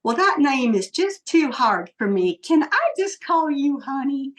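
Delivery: playful imitation of a Southern accent. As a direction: imitate a Southern captain asking sweetly. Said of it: "he does in this cutest little Southern accent".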